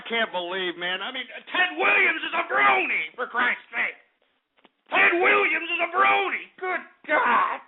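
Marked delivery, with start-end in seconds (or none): 1.5-7.6 outraged shout. Real, not acted. Radio host shouting in outrage that Ted Williams is a brony. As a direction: yell it in disbelief at the news.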